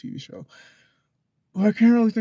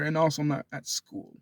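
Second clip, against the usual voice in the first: ordinary talk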